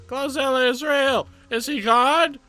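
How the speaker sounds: high loud frightened cry; panicked shout. Acted. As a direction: yell out in alarm. Character panics at the monster.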